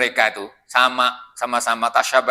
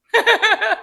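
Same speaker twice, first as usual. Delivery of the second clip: sudden high burst of laughter; real laugh breaking the sermon. Real, not acted. Laughing at human petulance.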